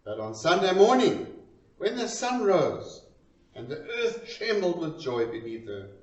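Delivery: plain reading voice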